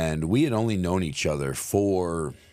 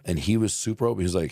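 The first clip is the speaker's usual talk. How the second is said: ordinary talk, nothing marked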